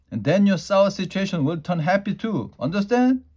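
high, loud, beaming showman; excited dad. Acted. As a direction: sell the lesson with a flourish. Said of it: "Daddy did a bow".

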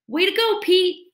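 high, cheering call; a friend's delighted shout across the camp; excited shout. Acted. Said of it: "shouts Glenn".

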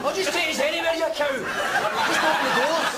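high, exasperated yell. Real, not acted. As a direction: lose patience with the lift, pitch up. Frustrated at the lift.

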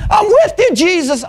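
high-pitched chest-out declaration; puffed-up confidence. Acted. Preacher acting out Peter pledging himself to Jesus, puffed-up confidence. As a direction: proclaim loyalty with mock swagger. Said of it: "spiritually puffed out his chest and said".

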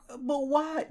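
a hint of a high whiny girl. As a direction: lightly mimic her plaintive why. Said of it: "She's like".

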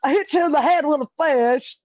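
put-on high, quick sitcom kid voice; quick, high Amanda Show voice; Amanda Bynes impression. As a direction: fire it off like a goofy teen sitcom line. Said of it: "the voice that's keep coming to my head is Amanda".

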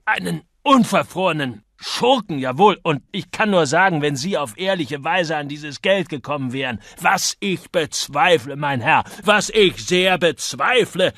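high, loud, emphatic insults; indignant tirade. A narrator's reading. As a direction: drive each accusation home, slowing on the doubts. Gasping man continues shouted insults.

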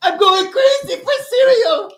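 hyped squeal. Pretend. Hyping up the snack.

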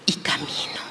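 whispered softly; hushed whisper at the end. Acted. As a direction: breathe the words out.

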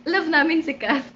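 normal talking voice

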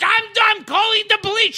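high, quick mock-angry yell; outraged shouting. Old boss refusing the model's pleas, in a fast outraged shout.

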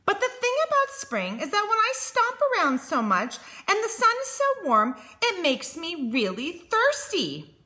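high, fussy rabbit, speeding up; animated, high-pitched bunny complaint; complaining storybook Rabbit. Acted. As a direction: grumble quickly in a high rabbit voice.

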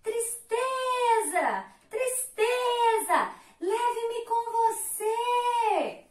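high, very slow sing-song begging; pleading for help. Acted. Drowning Love begs Sadness's boat, high slow plea. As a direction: stretch each word out in a long plea. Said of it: "o barco da tristeza".

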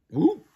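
squeaky high yelp; high whoop. Acted. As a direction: yelp on impact. Collision noise.

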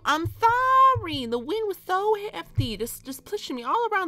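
high-pitched pleading sorry; whiny apology. Acted. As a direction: whine the excuse up high like a sorry kid. Whining excuse to mom character.

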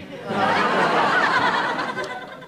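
embarrassed high-pitched snigger; high embarrassed giggle. Acted. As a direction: giggle like a naughty schoolboy. Ted giggles at rude word.

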